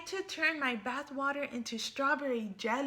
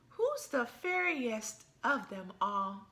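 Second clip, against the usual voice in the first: ordinary delivery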